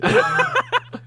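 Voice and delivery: high-pitched sudden giggle. Real, not acted. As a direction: burst into a squeaky giggle.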